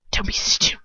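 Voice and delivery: put-on cold hissing whisper, English accent; Lucius. A narrator's reading. Answering Bellatrix's scolding, cold hiss. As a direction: hiss it coldly under the breath. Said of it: "Lucius replies coldly".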